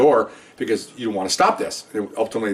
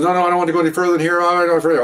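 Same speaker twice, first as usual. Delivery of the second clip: high, fast, louder protest; retold panicky refusal; 7 semitones higher, 4 dB louder, much faster than usual. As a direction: imitate a recruit hurriedly backing out. Recruit refusing to pursue the complaint.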